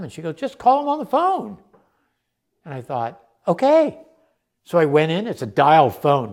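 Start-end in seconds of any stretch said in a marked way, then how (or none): 0.4-1.6 high, swooping spirited urging; spirited, spiky. Retold or imitated. Spirited friend telling swimmer to ring the Olympics, high and spiky. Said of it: "very spiky and really had a lot of spirit". 3.5-4.0 high, loud, taken-aback assent; surprised agreement. Retold or imitated. Swimmer agreeing to phone the Olympics, high surprised agreement.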